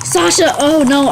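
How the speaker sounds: loud, high cry of alarm; dismayed cry. Real, not acted. Player who accidentally tagged a friend, dismayed cry. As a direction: call out the name in sudden dismay.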